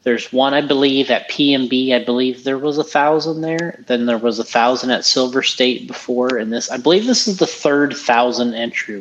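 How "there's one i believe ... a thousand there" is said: ordinary talk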